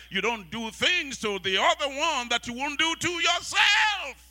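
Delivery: high, loud, slow shouted exhortation; preacher shouting to married couples; preaching shout. Real, not acted. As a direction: drive it home at full force. Preaching at full force.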